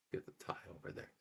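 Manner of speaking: whispering. Real, not acted. Aside while holding pet.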